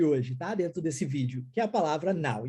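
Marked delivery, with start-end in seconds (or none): 2.1-2.5 NOW with an open "ah" gliding into "oo"; pronunciation of NOW. Shown as a demonstration. Teacher introducing the English word to students, clearly pronounced NOW. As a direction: say NOW like "nau". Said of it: "agora uma dica de pronúncia".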